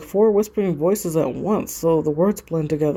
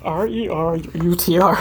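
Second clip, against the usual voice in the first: usual speaking voice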